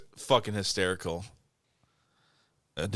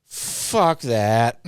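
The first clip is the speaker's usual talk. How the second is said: lightly voiced Muppet-like friend; a hint of Muppet in the retort; Muppety voice; 2 semitones lower, 3 dB louder than usual. Imitating friend's reaction to the shaved head, lightly Muppety. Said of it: "like, a Muppety voice".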